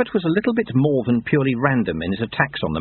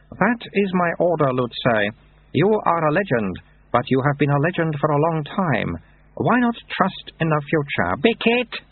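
put-on high, childlike abbot voice; baby abbot; 7 semitones higher than usual. A narrator's reading. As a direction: speak like a toddler with an old man's words.

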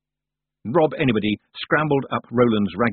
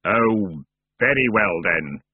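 sepulchral, resigned ferryman; reluctant dark figure; grudging spectre; slower than usual. Acted. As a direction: give in wearily, like a voice from the crypt.

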